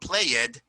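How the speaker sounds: "played" slowly in two syllables, play-ed; mispronounced -ed. Shown as a demonstration. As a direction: add an extra vowel before the end of "played". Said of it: "See, not played".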